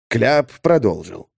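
ordinary delivery, nothing marked

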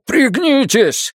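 high, stretched-out yell; shouted warning. A narrator's reading. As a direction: shout to make them take cover. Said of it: "захлопнул её и крикнул".

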